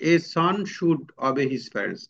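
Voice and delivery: very slow, word by word; slow dictation. Shown as a demonstration. Teacher dictating a sample sentence slowly. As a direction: spell out each word slowly for the class. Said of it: "যে".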